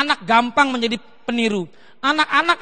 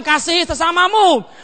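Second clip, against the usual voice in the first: slow, raised pulpit proclamation; imitated preacher in full voice; 5 semitones higher, 4 dB louder, slower than usual. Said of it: "Bapaknya di mimbar bilang".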